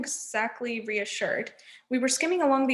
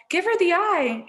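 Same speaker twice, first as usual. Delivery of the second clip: high, louder urgent scream; 7 semitones higher, 5 dB louder than usual. A narrator's reading. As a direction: scream it at Percy. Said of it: "Annabeth screamed".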